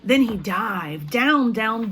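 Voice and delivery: ordinary talk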